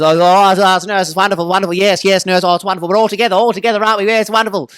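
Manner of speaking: high, loud, excited gushing; Geoffrey Rush in Shine. Retold or imitated. Speaker imitating the Shine pianist to the nurse, high excited gushing. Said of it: "his whole character is".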